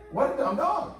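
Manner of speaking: put-on a boy amazed at how dumb the dog is; kid scoffing. The boy reacts to the lazy dog with a high scoff. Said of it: "And I'm like".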